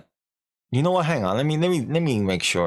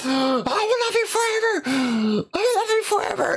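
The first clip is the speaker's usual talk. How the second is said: very high, shrieked vow of love; mock scream. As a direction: shriek the vow like a cursed bride. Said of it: "She screams at him".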